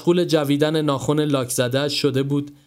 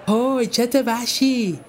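high, slow outraged yell; shouting; 10 semitones higher, slower than usual. Acted. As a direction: bellow at her for kicking the caps. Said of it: "میلاد داد زد".

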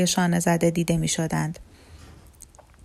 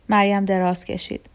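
usual speaking voice

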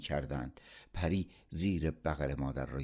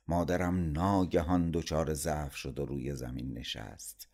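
ordinary delivery

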